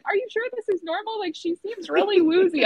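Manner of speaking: high-pitched anxious questioning; imitated concerned mom; worried mother. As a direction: fret aloud about your child. Worried mother questioning nurse, retold.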